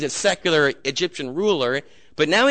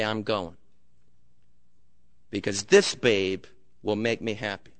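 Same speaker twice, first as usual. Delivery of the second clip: low, quiet and cold; steely resolve; 8 semitones lower, 6 dB quieter than usual. Acted. Husband leaves his wife of twenty-five years, steely and low. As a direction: announce it with hardened detachment. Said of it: "with steel in his eye".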